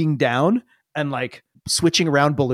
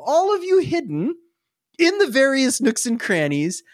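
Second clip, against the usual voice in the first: ordinary talk, nothing marked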